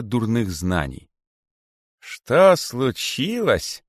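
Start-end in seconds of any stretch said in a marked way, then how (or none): 2.0-3.8 slow, high, bitter repeat; unheard-of bitterness. A narrator's reading. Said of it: "повторил великий питон с неслыханной горечью". (великий питон повторяет с горькой обидой)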